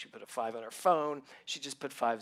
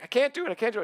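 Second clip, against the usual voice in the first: high, loud panicky pleading; imitating a terrified friend; panicked; 10 semitones higher, 8 dB louder, much faster than usual. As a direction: mimic a man losing his nerve. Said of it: "he had a nervous breakdown".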